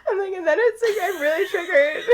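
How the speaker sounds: giggling through words; breaking into laughter; laughing while talking. Real, not acted. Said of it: "laugh cry".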